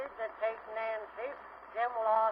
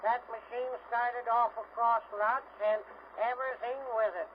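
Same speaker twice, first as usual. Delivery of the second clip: usual speaking voice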